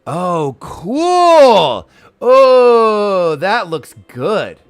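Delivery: loud, high, long-drawn oohing; thrilled. Real, not acted. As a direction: gush loudly, stretching each exclamation. Spots an outfit she likes.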